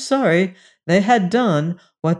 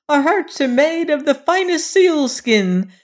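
very high, louder dwarf voice; squeaky creature denial; 10 semitones higher, 3 dB louder than usual. Acted. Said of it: "they said".

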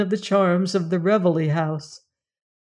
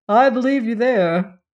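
with a smile; 4 semitones higher, 4 dB louder than usual. A narrator's reading. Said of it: "said Crane with a smile".

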